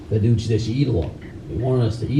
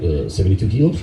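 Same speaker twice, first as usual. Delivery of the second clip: laughing unsure answer; 5 semitones lower than usual. Retold or imitated.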